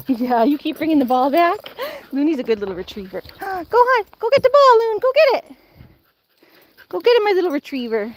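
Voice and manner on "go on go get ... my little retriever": high, loud cheering; squealing go-get-it; excited urging. Real, not acted. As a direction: push the dog to fetch. Urging dog after ball.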